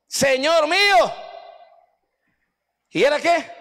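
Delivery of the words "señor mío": drawn-out cry, pitched high; acted cry out. Man who lost the axe cries to his master, crying out. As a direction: call out in distress, stretching the words. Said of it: "gritó y dijo".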